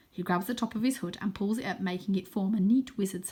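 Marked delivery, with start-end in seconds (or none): none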